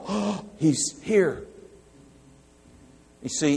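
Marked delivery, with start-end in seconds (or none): 0.1-1.4 high, slow, deflated groan of disappointment; mock dismay. Preacher mocking a reluctant believer, high slow mock dismay. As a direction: droop it like the arrival is bad news. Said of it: "not going".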